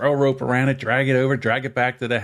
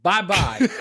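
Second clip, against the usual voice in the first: heated argument; 9 semitones higher than usual. Retold or imitated. Argues his point to dad.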